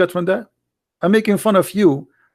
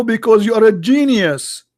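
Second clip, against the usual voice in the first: ordinary delivery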